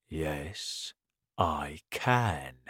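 low, soft, sly reply; hushed storybook villain; quietly menacing crocodile. Acted. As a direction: answer softly, relishing the threat. Crocodile calmly menaces monkey.

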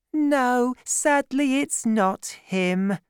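high, slow let-down voice; disappointed young woman. A narrator's reading. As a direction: let the hope drain away. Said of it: "Madame Bonacieux was saying".

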